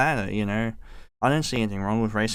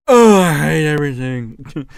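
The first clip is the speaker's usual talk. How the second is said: much louder, slow, put-on moan of misery; groggy despair; 3 semitones higher, 10 dB louder, slower than usual. Waking up at eleven after oversleeping, hammed-up misery. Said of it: "it's like".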